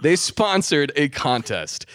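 usual speaking voice

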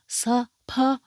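"saparanga" broken into separate syllables; Korean pronunciation. Shown as a demonstration. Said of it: "That's how you would say it in Korean".

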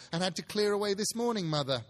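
a daughter announcing herself; girl's voice. Acted. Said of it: "replied the girl".

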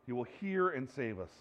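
ordinary talk

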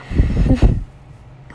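loud, breathy burst of real laughter; can't stop laughing. Real, not acted. Reader loses it mid-story, real loud laugh. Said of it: "Sorry about that".

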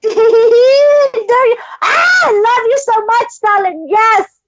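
high, loud gushing squeal over a dog; overjoyed high cry of love; excited squeal. Real, not acted. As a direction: squeal with real delight, almost crying.

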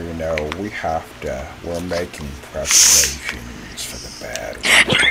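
low, winding-down delivery; really low voice. Real, not acted. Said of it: "He talks in that really low voice".

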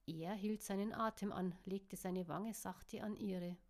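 plain reading voice

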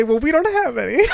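quoted high and laughing; laughing quote. Retold or imitated. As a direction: sneak in the punchline while cracking up. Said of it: "I thought you were gonna say".

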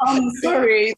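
loud, high, drawn-out mimicry; exaggerated imitation of strained talk; mock gritted-teeth impression. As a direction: push it out through gritted teeth, mocking her. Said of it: "talking through her nose and gritting her teeth".

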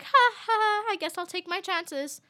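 high mock ha-ha, then play along; put-on polite laughter into a reply; awkward fake laugh. Acting out her nervous laughing reply.

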